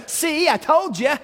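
high, slow smug "told you"; gloating. Retold or imitated. Preacher playing himself gloating at Jesus, slow smug tone. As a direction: drag it out like you won the bet. Imagined gloating next morning.

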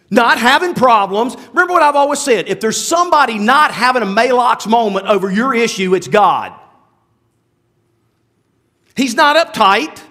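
usual speaking voice

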